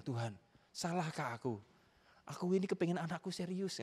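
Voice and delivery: high-pitched acted plea; pleading prayer, retold. Father praying while driving, acted as a high plea. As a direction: plead your case to God. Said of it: "Saya ngomong sama Tuhan gini".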